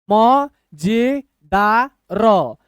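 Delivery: very slow, long gaps between syllables. Shown as a demonstration. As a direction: sound out every letter separately. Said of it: "एक एक अक्षर आपको तोड़ के बता रहे हैं".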